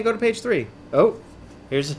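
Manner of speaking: plain reading voice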